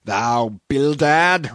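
slow, loud roar of outrage. A narrator's reading. Peleg explodes at his partner Bildad, roaring. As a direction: roar it out, drawing out each word. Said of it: "roared Peleg".

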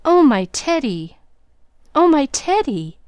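rising joyful cries of a name; overjoyed greeting; joyful cry. A narrator's reading. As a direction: cry the name out with growing joy. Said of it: "flew up crying joyfully".

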